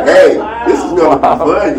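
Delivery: bright mock shout of excitement; eager. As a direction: cheer the idea on. Excited imagined self-quote.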